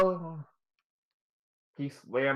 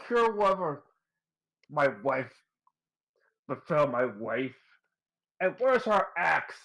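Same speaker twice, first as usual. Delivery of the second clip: high, urgent shouting for help; urgent call; 5 semitones higher than usual. Acted. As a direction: hurry the healer over in alarm. Husband NPC calls for help.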